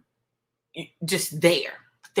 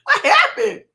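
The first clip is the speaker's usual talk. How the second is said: much higher, louder, frantic imitation of a son; panicked big brother; 7 dB louder than usual. Mother imitating her older son on FaceTime, hammed-up panicked squeal. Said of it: "And he's like".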